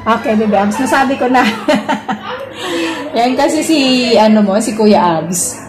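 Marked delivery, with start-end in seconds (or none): 1.5-3.1 high, soft peal of laughter; triumphant giggle. Real, not acted. Streamer laughing after conquering the name, high giggle. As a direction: giggle helplessly after pulling it off.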